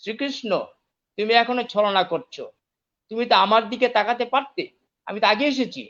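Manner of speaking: a hurt prince protesting unfair treatment; aggrieved sulking. Acted. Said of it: "অভিমানী দুর্যোধন বললেন".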